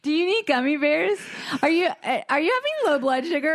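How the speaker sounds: mock concern. Mock concern for rude person.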